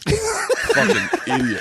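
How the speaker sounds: high slow insult through laughter; laughing insult. Retold or imitated. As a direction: drag it out while laughing.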